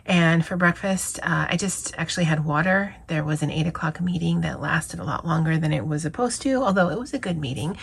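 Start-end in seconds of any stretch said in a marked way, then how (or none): none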